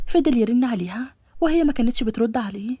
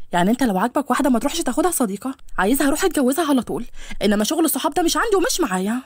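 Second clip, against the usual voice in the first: usual speaking voice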